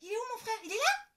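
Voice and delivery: put-on high, loud searching child; girl calling out door to door; child's cry. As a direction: shout again for her brother. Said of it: "crie Lola".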